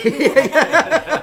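loud high laugh. Real, not acted. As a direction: burst of laughter. Laughing at own story.

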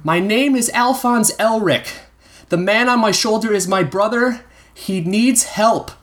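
put-on high boyish voice calling across the bridge. A narrator's reading. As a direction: plead for help, slowing at the end. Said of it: "he called to him".

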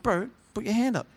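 quick hushed urging whisper. Retold or imitated. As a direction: nudge your mate under your breath. Whispering back to mate.

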